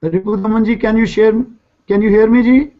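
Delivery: normal talking voice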